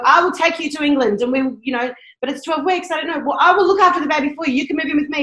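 good women offering help eagerly. Retold or imitated. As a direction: rush in to offer her a home. Women offering help, voiced in story.

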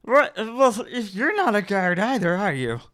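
a bystander calling their bluff; suspicious townsman. Acted. Crowd challenging, loud.

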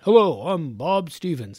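lightly acted full-name introduction; a touch of a movie character introducing himself; movie-style introduction. Host mimicking movie characters giving full names, lightly put-on introduction. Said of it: "like, in movies".

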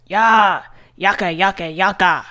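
half-restrained playground war whoop; mild war-cry yell. A narrator's reading. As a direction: narrator voicing a boy's yelled war chant. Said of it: "beating a tin with his fist and yelling".

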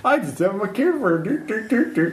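high singsong innocence; faux innocent. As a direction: act casual in a high voice.

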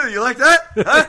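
high loud teasing taunt. Acted. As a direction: goad him playfully. Taunting after laugh.

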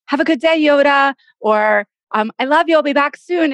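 doting goodbye to dog. Retold or imitated. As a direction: coo lovingly at the dog. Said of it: "where you go".

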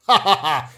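loud, performed "ha ha ha"; mock laugh. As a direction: laugh pointedly, as if sharing a joke. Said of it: "Shared laugh".

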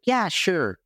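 high-pitched, casual yes; breezy agreement. Retold or imitated. Woman agrees to the speaker's offer of a talk, breezy agreement.

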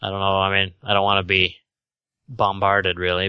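ordinary delivery

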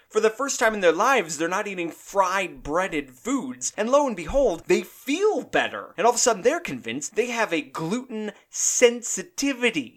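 high, loud comic tirade; building rant. Acted. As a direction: wind up into a full rant and lean on each punchline. Ranting build about gluten trend.

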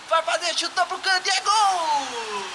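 explosive goal commentary. Pretend. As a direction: stretch the goal call out. Goal shout in mock narration.

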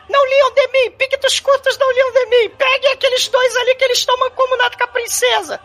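very high squeaky baron; high villain voice. Retold or imitated. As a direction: bark orders in a high voice.

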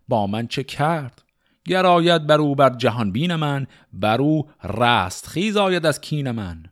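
measured, rhythmic reading of Persian epic poetry; شعرخوانی حماسی; epic verse recitation. Acted. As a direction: recite it like a storyteller of old epics.

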